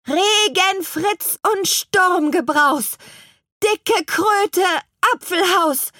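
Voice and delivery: very high, measured rhyming incantation; witch casting spell. Acted. Petronella commanding the branches to hold still, high witchy chant. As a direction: chant the magic rhyme high and deliberately.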